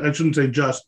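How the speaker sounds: ordinary talk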